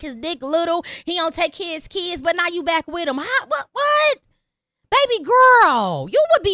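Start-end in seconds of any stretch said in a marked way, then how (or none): 4.9-6.5 condescending. Real, not acted. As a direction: talk down to her sweetly. Condescending address to listener.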